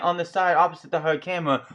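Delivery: usual speaking voice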